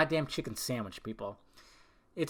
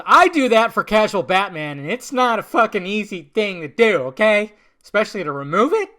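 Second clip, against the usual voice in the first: high, loud aggrieved rant; loud indignant outburst; 6 semitones higher, 12 dB louder than usual. Real, not acted. Casual Batman cosplayer complains about eye makeup, indignant outburst. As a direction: burst out in loud, high-pitched protest.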